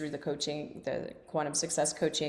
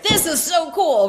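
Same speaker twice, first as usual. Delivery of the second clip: louder, higher, gushing; excited fan; 11 semitones higher, 10 dB louder than usual. Retold or imitated. Community members leaning in, loud excited gush. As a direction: gush like a thrilled newcomer leaning in. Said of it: "others like".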